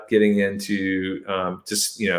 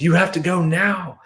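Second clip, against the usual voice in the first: higher, urgent command; urgent insistence; 9 semitones higher than usual. Retold or imitated. Man urging the hidden woman to flee now, urgent. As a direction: push her to leave right now. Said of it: "I'm like".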